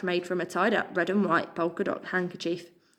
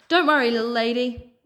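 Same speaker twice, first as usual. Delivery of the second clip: put-on cooing American accent, a touch higher and louder; 4 semitones higher, 4 dB louder than usual. A narrator's reading. Pigeon reassuring the frightened mouse, American accent. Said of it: "a deep velvety voice cooed in an American accent".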